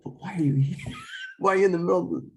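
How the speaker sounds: high, breathy mock disbelief with a laugh; imitating a cop who can't believe it; incredulous cop. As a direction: play the baffled cop, pitching up. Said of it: "He's like".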